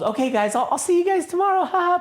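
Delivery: high, sugary fake-friendly voice; fake nice. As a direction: put on a sickly sweet goodbye. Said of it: "pretending to be all nice".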